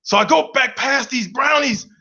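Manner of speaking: normal talking voice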